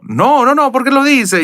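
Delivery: high-pitched, hurried-sounding retold protest; acted high-pitched innocent denial; flustered denial. Retold caught imitating, flustered denial.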